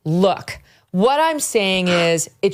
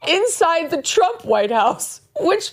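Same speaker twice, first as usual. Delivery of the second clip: incredulous; 8 semitones higher, slower than usual. Real, not acted. Incredulous over her gaffe.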